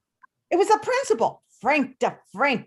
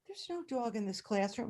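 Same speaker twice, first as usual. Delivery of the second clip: quiet denial; 11 dB quieter than usual. Retold or imitated. Teacher calmly denies to principal.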